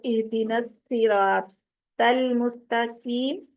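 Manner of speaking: الصراط said with a thin س and المستقیم with a light ت, mistakes shown on purpose; wrong pronunciation demo. Shown as a demonstration. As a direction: demo of the error, صاد as سین and قاف as تا. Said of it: "صاد کو سین کی طرح پڑھ دیا".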